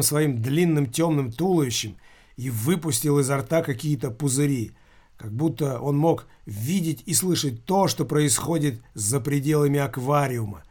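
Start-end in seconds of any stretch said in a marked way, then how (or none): none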